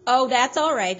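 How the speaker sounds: loud, quick placating reassurance; smooth it over fast before a fight; hasty backpedal. A narrator's reading. Onlooker backing off from angry Charles, loud hasty placating. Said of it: "the man said quickly".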